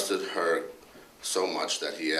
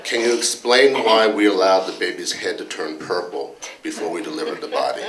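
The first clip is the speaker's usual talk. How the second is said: louder, a touch indignant complaining colleague; Dr. Anderson; 2 semitones higher, 9 dB louder than usual. Retold or imitated. Colleague grilling the department head about hospital rules, mock indignant. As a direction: press the boss with mock exasperation, half-laughing. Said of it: "went something like this".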